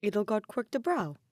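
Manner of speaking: usual speaking voice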